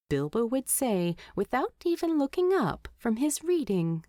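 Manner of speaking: usual speaking voice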